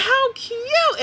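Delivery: high, gushing squeal of delight; gushing "how cute". Retold or imitated. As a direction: coo it like something adorable. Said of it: "And I was like".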